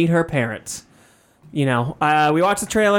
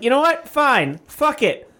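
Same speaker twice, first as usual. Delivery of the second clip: high-pitched mock giving up; lightly acted fed-up outburst; acted exasperation; 9 semitones higher, slower than usual. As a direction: throw up your hands, pitched high. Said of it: "I was like".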